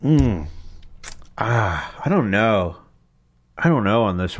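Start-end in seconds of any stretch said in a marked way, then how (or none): none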